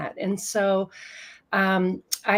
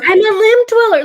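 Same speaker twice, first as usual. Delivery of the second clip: very high, very loud proud cry; exultant declaration; 14 semitones higher, 15 dB louder than usual. Acted. Guest echoes the host's realisation, exultant shout. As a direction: shout it out like a liberation.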